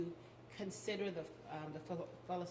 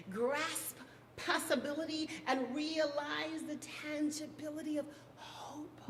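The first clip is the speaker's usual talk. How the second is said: oratorical spoken-word tribute, higher and louder than chat; rhythmic, swelling appeal with dramatic pauses; impassioned poetry recitation; 6 semitones higher, 7 dB louder, slower than usual. Acted. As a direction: deliver a stirring roll call, leaning on key words.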